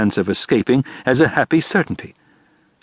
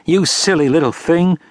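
sharp; 7 semitones higher than usual. A narrator's reading.

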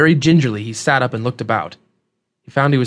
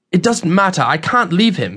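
put-on higher, insistent young man's voice; lightly voiced protest, pitched up and urgent; determined young traveler; 6 semitones higher than usual. Young man refuses to leave his friend, insistent.

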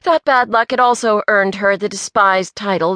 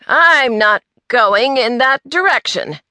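higher, louder declaration; indignant declaration; 6 semitones higher, 5 dB louder than usual. A narrator's reading. Earl refuses to pass the group, declaring it loudly. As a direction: declare it stubbornly in a put-on voice. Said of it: "Earl declared".

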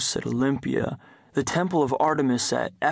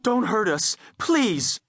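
sobbing plea; 8 semitones higher, slower than usual. A narrator's reading. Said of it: "my mother sobbed".

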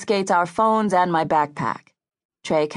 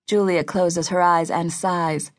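ordinary delivery

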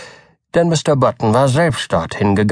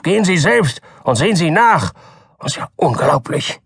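raised, indignant voice; furious doctor; 6 semitones higher than usual. A narrator's reading. Doktor Keene angrily sends Button to look himself. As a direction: scold him sharply. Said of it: "rief Doktor Keene voll Wut".

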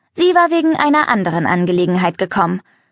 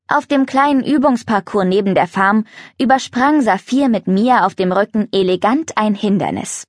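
usual speaking voice

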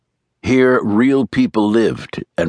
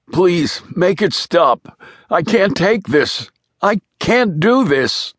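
high-pitched, slow anguished begging; desperate pleading; 9 semitones higher, slower than usual. A narrator's reading. Tearful man begging for the test to stop, desperate pleading. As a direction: plead as if you can't bear it.

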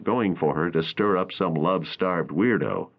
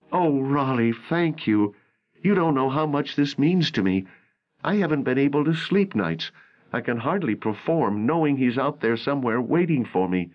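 raised, lightly voiced young actress; a worried young actress pleading, narrator lifts his pitch higher; April's voice; 7 semitones higher than usual. A narrator's reading. Narrator voicing April to Raleigh, worried and higher.

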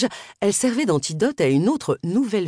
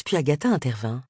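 ordinary delivery

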